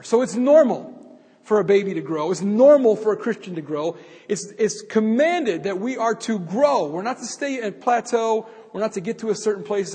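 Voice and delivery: loud, building sermon delivery; preaching. Acted. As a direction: preach it out to the congregation. Preaching at full force.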